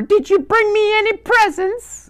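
very high, loud falsetto granny; wolf posing as grandmother. Acted. Disguised wolf asking grandson for presents, in loud falsetto. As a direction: ask eagerly in a squeaky disguise voice. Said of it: "he changed his voice".

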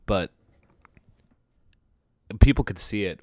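ordinary delivery

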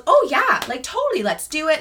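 high, mock-enthusiastic voice; agreeable stylist. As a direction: imitate someone breezily agreeing to everything.

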